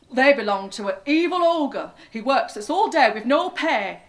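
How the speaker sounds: loud workers' complaint; workers complaining. A narrator's reading. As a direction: grumble it out loudly as the field workers. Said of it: "they said".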